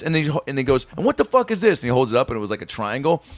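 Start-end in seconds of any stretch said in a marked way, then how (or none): 1.0-1.8 high, outraged mock challenge; retold angry demand. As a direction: imitate a boss appalled by bad work. Retold boss holding up bad square.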